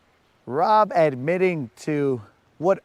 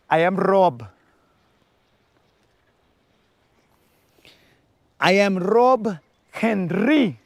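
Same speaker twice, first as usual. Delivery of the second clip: rolled-R Spanish flair; 3 semitones higher, slower than usual. Retold or imitated. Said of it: "do you roll your R's?".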